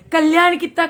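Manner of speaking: कल्याण कितना called out loud. Retold or imitated. Guruji calling out loudly.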